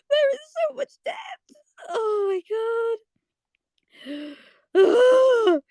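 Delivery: very high, drawn-out cry of delight; overwhelmed squeal. Real, not acted. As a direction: wail it high, breaking up the last words. Fan overwhelmed by the moment.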